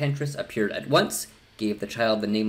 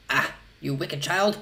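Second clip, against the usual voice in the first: angry cry; 5 semitones higher than usual. A narrator's reading. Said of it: "cried the enchantress".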